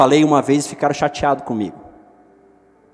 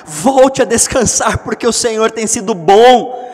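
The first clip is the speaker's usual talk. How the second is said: loud, raised psalm proclamation; preaching the psalm; 6 semitones higher, 8 dB louder than usual. Acted. Reading psalm at full force. (proclamação do salmo em voz alta)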